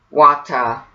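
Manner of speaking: British-accent Water with the R dropped. Shown as a demonstration. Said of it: "let's try it in a British accent".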